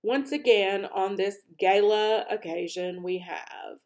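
loud ceremonial speech; grand public oration; royal address. A narrator's reading. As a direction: address the hall as a king. King's speech to empty room.

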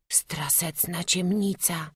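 slow unvoiced scared whisper; frightened whisper. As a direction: hush it out, afraid of the dark. Boy scared in the dark.

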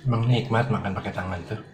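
chewing between words; talking around food; mouth partly full. Real, not acted. Seen 0: Eats by hand throughout; lips bunched between words, mouth seems occupied.

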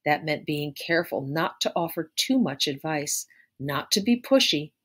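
plain reading voice

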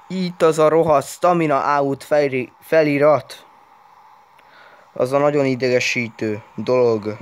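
loud, exasperated complaining; annoyed. Real, not acted. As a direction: scold the lagging phone. Frustrated at lagging phone. (idegesen, hangosan)

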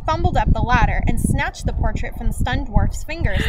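ordinary delivery, nothing marked

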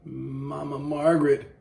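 high, loud, slow call across the room. Real, not acted. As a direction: call her name out long. Calling out to someone nearby.

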